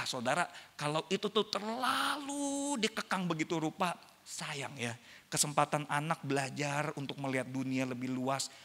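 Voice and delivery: usual speaking voice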